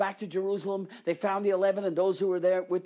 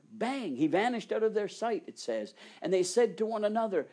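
ordinary talk

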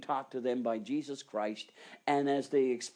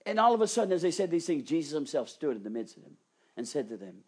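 normal talking voice

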